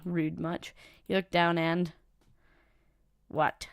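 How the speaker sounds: ordinary talk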